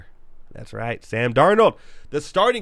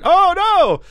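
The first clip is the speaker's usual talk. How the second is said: mock shock; 8 dB louder than usual. Mock alarm at own spoiler.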